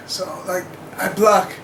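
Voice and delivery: ordinary talk, nothing marked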